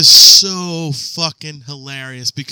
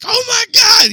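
much higher, loud shout of disgust; shocked shout; 7 dB louder than usual. Retold or imitated. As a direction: yell it out in horror. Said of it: "Paul was like".

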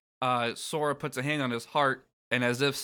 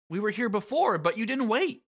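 higher, complaining hero voice; Sora; 9 semitones higher than usual. Acted. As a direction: whine it a little as the young hero. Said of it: "Sora says".

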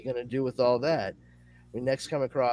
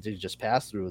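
usual speaking voice

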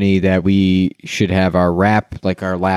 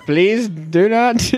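higher, very slow, strained polite plea; exasperated rose seller begging the drunk customer to behave; pleading vendor; 10 semitones higher, much slower than usual. Retold or imitated. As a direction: beg him to stop, half laughing. Seller pleading, breaking character.

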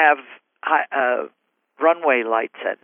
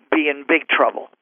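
ordinary delivery

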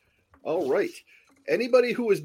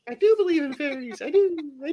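high fairy-believer voice; 12 semitones higher than usual. Acted. Peter Pan fairy quote.

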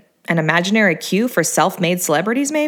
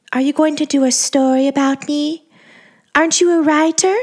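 high breathy voice; 7 semitones higher than usual. A narrator's reading. Angeline's voice, hopeful yet wary.

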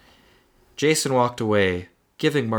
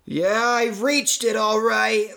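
angry yell; 11 semitones higher, slower than usual. Acted. Marty answering the voice in his head, angry yell. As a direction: snap back in exasperation. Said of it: "Marty yelled aloud, his anger echoing".

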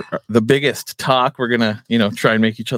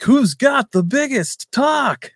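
high, drawn-out announcer call; slow, soaring showman's reveal; game show host; much slower than usual. Shown as a demonstration. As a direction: stretch out the big question like a game show host. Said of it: "pretty good game show host voice".

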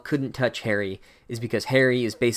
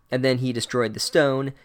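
ordinary talk

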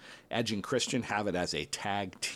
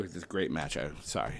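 normal talking voice

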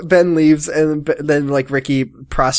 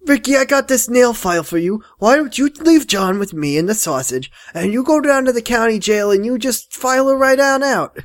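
higher, mock-sly; mock Leo; 8 semitones higher than usual. Parodying Leo scheming to Ricky, higher mock voice. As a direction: pitch it like a sly boss.

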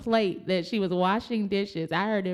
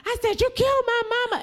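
frantic accusation; faster than usual. Retold or imitated. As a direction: hurl it at him as if losing your mind. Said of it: "and I went crazy".

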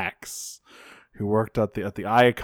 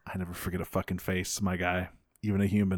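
low, quiet, fast threat; menacing low growl; 5 semitones lower, 10 dB quieter, faster than usual. Retold or imitated. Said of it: "he says".

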